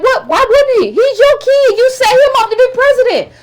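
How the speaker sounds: high, indignant disbelief; incredulous. Real, not acted. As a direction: pitch it up in outraged disbelief. Incredulous rant about Bush's son.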